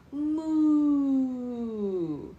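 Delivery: put-on mother showing her baby how a cow sounds; cow moo. Shown as a demonstration. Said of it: "Can you go".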